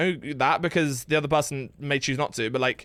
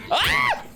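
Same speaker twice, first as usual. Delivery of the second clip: startled yelp. Real, not acted. Jump scare in horror game.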